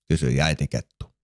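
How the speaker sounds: plain reading voice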